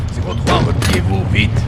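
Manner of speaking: urgent shouted command; retreat order. Acted. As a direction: bark the order to fall back. Commander orders retreat under attack.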